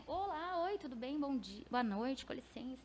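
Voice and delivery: lightly voiced polite greetings, trailing off soft; a hint of timid courtesy at the door; mock polite greeting. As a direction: pretend to politely greet your way in, quietly. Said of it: "Falar, né?".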